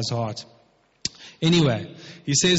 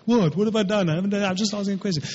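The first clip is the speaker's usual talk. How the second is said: higher, hurried mock kid protest; imitating little kids; 4 semitones higher, faster than usual. Preacher mimicking his little kids' response, mock childish protest. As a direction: rattle it off like a child protesting innocence. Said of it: "exactly how my little kids respond".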